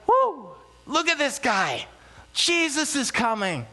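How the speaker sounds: high, drawn-out mock cheer; crowd cheering. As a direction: imitate an excited crowd hailing an arrival. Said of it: "they're shouting".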